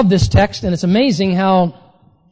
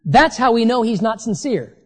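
ordinary delivery

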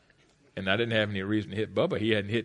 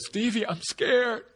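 scared mother; 11 semitones higher, slower than usual. Retold or imitated. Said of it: "She pulled me close".

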